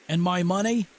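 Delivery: high-pitched calling demand; yelled question. A narrator's reading. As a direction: call the question across the water. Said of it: "Hartness yelled".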